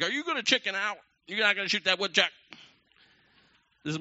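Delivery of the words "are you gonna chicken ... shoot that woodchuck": high, loud, rushed child imitation; kids taunting Dad. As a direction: goad Dad like mocking kids.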